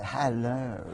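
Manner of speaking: slow, suggestive Cockney-free hello; suave drawn-out hello. Shown as a demonstration. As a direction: purr it like a charming cad. Says his famous catchphrase.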